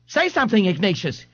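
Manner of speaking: high, frantic pleading. Acted. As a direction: beg him to answer. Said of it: "his mother pleaded".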